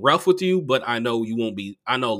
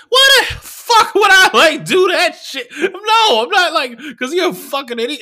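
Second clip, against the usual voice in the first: outraged yell; 12 dB louder than usual. Retold or imitated. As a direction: shout an incredulous refusal. Retelling outraged refusal to pay.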